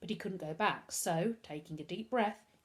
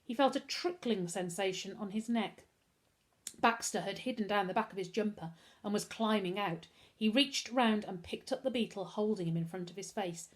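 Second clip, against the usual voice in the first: usual speaking voice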